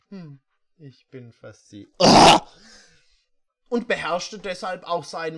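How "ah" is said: sudden loud mock scream; short hammed-up scream. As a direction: yelp out a fake shriek.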